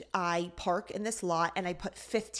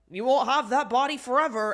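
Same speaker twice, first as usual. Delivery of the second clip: put-on loud, higher leering old-man voice; creepy old man; 4 semitones higher, 7 dB louder than usual. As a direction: play the sleazy old guy.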